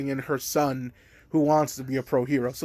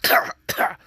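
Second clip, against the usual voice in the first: cough; 3 dB louder than usual. Real, not acted. After voice crack.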